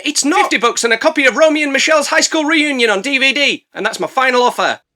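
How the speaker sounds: high, loud insistent bidding; eager haggler. Acted. Romy bidding over the kid's objections, high pushy haggling. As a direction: blurt out your bid as a hammed-up haggler.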